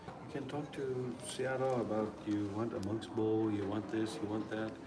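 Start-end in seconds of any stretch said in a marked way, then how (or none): none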